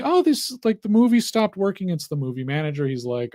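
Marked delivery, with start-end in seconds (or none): 0.0-1.9 higher-pitched mock complaint; complaining. Lady complaining to the manager, mock-indignant and high. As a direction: play an indignant moviegoer griping. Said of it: "She's complaining".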